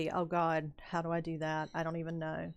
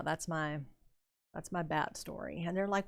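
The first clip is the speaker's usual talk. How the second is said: usual speaking voice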